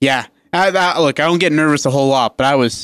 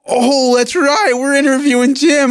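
much higher, slow, hammed-up dawning surprise; mock sudden realization; 10 semitones higher than usual. Host mimics co-host remembering the interview, mock surprised. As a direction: play the dopey penny-drop moment, stretched out.

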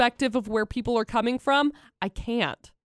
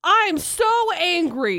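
high, slow, hammed-up anger; hammed-up outrage, stretched out; mock anger; 7 semitones higher, 3 dB louder, much slower than usual. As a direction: drag out fake fury.

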